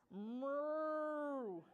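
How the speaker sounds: long, swelling cow moo. Acted. Cow inside the puppy.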